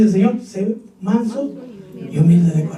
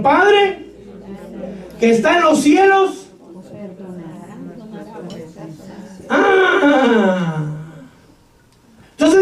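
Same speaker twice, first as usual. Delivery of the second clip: preaching at full force; 5 semitones higher, slower than usual. Acted. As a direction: thunder it from the pulpit. Predicación a pleno pulmón. (predicación a todo pulmón, voz alta y lenta)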